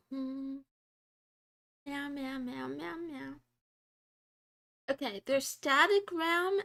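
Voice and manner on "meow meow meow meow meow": softer, playful kitty meows; soft cat meows. Pretend. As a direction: meow like a little cat. Streamer meowing like a cat.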